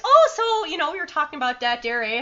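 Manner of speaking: put-on high, sing-song Minnesotan accent; Minnesota Nice villain. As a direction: play a sweetly chatty Minnesotan. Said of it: "villain called Minnesota Nice".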